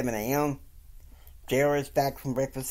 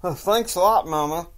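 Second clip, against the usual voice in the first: angry sarcasm; 6 semitones higher, 8 dB louder than usual. Retold or imitated. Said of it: "And Kyle's angry".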